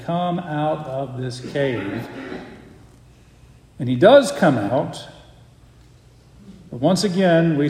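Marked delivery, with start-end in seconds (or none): 0.0-2.1 softer, slow coaxing invitation; God's soft voice calling Elijah out; gentle call. Retold or imitated. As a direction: speak it tenderly, softly, slowly. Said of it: "gentle voice, soft voice".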